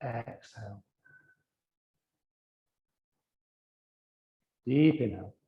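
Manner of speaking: low, soft cues with long silences between; guided breathing meditation. Real, not acted. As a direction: calmly guide each breath, letting the words fade. Leads a breathing exercise.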